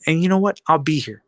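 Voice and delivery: ordinary talk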